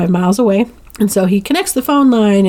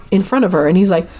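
ordinary talk, nothing marked

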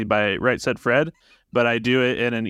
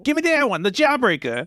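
put-on much higher, childlike voice; squeaky kid begging at a candy store; 9 semitones higher than usual. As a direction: play an eager little boy asking for candy. Child role in improv skit.

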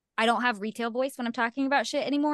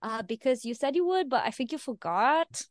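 put-on baby voice; 5 semitones higher than usual. Shown as a demonstration. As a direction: timidly wheedle a favour in baby talk. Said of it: "we use that voice if there's conflict".

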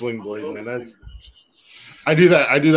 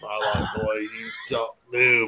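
Sling Blade quote; 2 semitones lower, 5 dB quieter than usual. Retold or imitated. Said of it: "he's, he's like".

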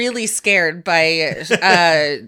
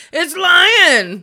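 sudden loud high yell, it's lion; yelling the name in triumph; shouted reveal; 11 semitones higher, 10 dB louder than usual. Retold or imitated. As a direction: burst out with the name, far above the rest. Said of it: "he goes".

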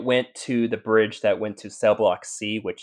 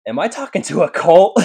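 higher, louder incredulous question breaking into laughter; laughing disbelief; 8 semitones higher, 7 dB louder than usual. Retold or imitated. Investigator questioning the many Roberts, amused disbelief. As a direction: ask it high and loud, amused and baffled. Retold baffled reply, laughing.

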